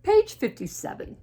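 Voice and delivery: high, loud announcement; bright storybook declaration; Piggy. Acted. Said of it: "and she says".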